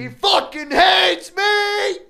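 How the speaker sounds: high, slow, loud screamed lyric; screaming. Acted. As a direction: scream it at full voice. Screaming [name] lyric.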